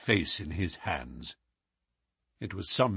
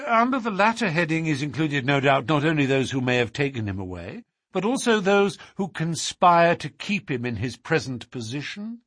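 lightly voiced detective, pitched up; formal, assured Holmes; 5 semitones higher, 3 dB louder than usual. Holmes asks the Duke to confirm the reward, formal and assured. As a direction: state the case formally in a higher register.

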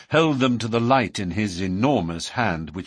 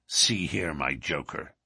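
lightly voiced threatening lodge boss, lower; menacing boss; 6 semitones lower, 4 dB quieter than usual. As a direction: warn the newcomer with quiet menace. McGinty menaces the newcomer.

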